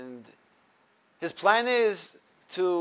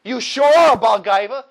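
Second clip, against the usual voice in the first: loud, a touch higher retort; screaming back; 5 semitones higher, 12 dB louder than usual. Retold or imitated. The man on the bench screams back at the intruder, loud. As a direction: fire the accusation straight back. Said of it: "screams back at him".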